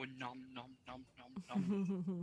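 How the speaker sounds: soft mock eating sounds; nom nom chomping. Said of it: "no one was hearing me go".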